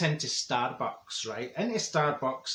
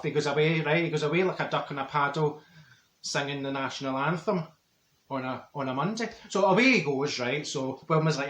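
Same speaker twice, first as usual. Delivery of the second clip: ordinary talk, nothing marked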